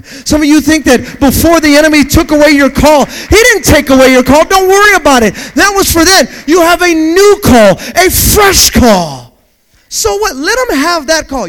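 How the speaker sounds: loud, high preaching shout; full-force preaching. Acted. Preacher shouting to the congregation, loud and high. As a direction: lift the church with a shouted promise.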